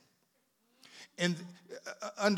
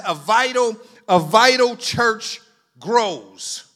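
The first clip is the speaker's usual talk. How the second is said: "vital" hit louder, slowly drawn-out phrase; stress on vital; 3 semitones higher, 9 dB louder, slower than usual. Real, not acted. Preacher reading the header, heavy stress on "vital". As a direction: punch "vital" and stretch out the rest. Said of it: "I stressed the word vital".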